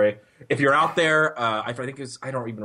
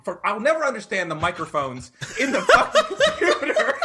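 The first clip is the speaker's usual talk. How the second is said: laughing exasperation; 9 semitones higher, 4 dB louder than usual. Real, not acted. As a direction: pitch climbing into helpless laughter. Cracks up telling own blunder.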